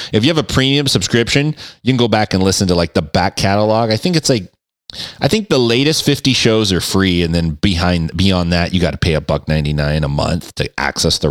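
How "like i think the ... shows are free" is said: usual speaking voice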